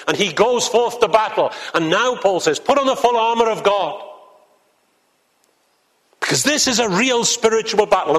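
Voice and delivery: rising, fervent pulpit delivery; preaching at full force. Acted. Preacher rousing the congregation about the spiritual battle, fervent. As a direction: build it like a call to battle.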